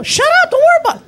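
very high, loud screech back; exasperated yell. Retold or imitated. Driver yelling back at friend in back seat, screeching. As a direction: screech it to silence the nagging.

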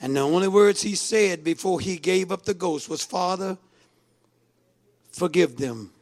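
low, grave voice with long pauses; hushed solemn preaching. Real, not acted. Preacher recalls Jesus forgiving from the cross, hushed.

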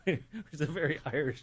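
words squeezed out through laughter; talking through real laughter; laughing while talking. Real, not acted. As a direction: still laughing, forcing the words out.

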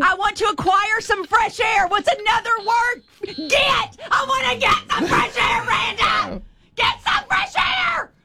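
high-pitched, frantic yelling; exasperated shouting. Real, not acted. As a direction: plead at the top of your range for the answer. Exasperated, repeating clue after wrong guesses.

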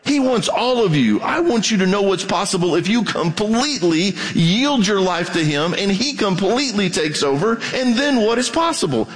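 usual speaking voice

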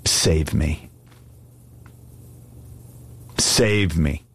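understated, subdued plea with long pauses; cry of the soul. Acted. Preacher voices a listener's cry to God, quietly pleading. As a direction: plead simply, leaving space after each plea. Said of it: "is this a cry of your soul".

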